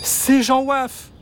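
put-on high, slow eager call of a boy; boy's excited cry. A narrator's reading. Boy calls out to his dog, excited. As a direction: call out the dog's name in delight. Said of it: "s'écria le petit garçon".

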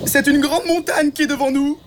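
very high bird announcing; blackbird voice. A narrator's reading. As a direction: bird reporting a mountain. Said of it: "annonça-t-il".

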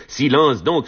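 mild narrated shout. A narrator's reading. Thémistocle hushing the squabblers, restrained shout. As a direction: cry out, but keep it contained. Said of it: "cria Thémistocle".

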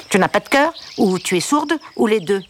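the upset duck, nasal and a little weepy; a hurt duck scolding the girl between sniffles; nasal, sniffling duck. A narrator's reading. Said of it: "demanda la voix nasillarde entre deux gros soupirs et un énorme reniflement".

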